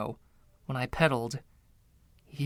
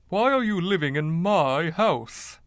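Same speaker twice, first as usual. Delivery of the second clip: indignant ghost; 5 semitones higher, 3 dB louder than usual. Acted. Ghost demands of intruder.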